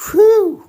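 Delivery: big high, loud whew of relief; relieved whew. Real, not acted. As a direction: blow out the tension in one high whew. Relief after tough session.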